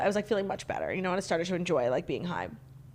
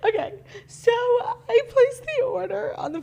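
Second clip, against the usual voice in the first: slow, very high squeal through laughter; laughing confession; 12 semitones higher than usual. Real, not acted. Starting an embarrassing story, high slow laughing squeal. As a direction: force the words out through giggles.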